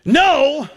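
NO leaps high after a pause; high, forceful, drawn-out NO; emphatic no. Acted. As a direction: turn someone down with a high NO. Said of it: "who have gotten that emphatic".

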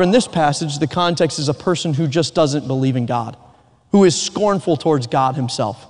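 ordinary talk

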